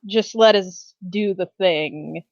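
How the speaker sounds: ordinary delivery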